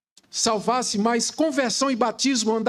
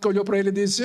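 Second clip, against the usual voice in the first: ordinary delivery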